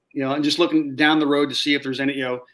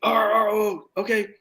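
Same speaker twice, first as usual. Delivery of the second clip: higher, stammered surprise; relieved oh okay; 8 semitones higher, slower than usual. Retold or imitated. Squad realizing it's their own scout, surprised higher ohs. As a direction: caught off guard, then relieved.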